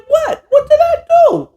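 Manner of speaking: very high mock-innocent whine; innocent Champ. Champ feigning innocence to Akeem, very high whine. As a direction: play dumb in a squeaky voice. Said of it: "Champ is like".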